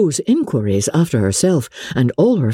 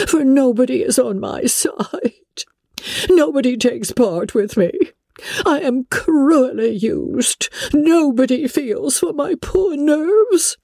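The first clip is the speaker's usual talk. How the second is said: high, self-pitying matron voice; fretful mother, high and put-upon; aggrieved Mrs Bennet; 12 semitones higher than usual. A narrator's reading. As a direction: play the wronged mother of nerves. Mrs Bennet complains of nerves.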